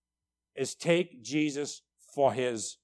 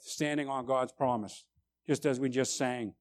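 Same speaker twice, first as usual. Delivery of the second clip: normal talking voice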